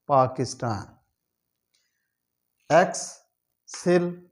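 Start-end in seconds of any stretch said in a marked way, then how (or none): 2.7-4.2 excellent drawn out slowly, syllable by syllable; slow syllable drill. Shown as a demonstration. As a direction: stretch excellent into slow parts. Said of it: "syllable drilling". (Excellent को धीरे-धीरे टुकड़ों में बोलना)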